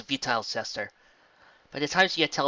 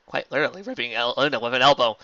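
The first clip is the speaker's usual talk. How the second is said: plain reading voice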